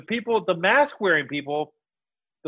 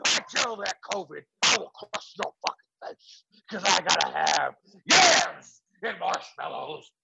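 gravelly Hulk-style promo, brother; wrestler voice. Acted. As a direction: growl it like a pro wrestler. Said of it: "You look like a wrestler".

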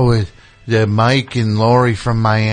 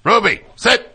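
commanding dog; 10 semitones higher than usual. Real, not acted.

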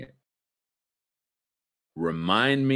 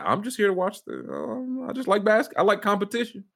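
mock innocent; 11 semitones higher than usual. Mocking Skip's innocent excuse.

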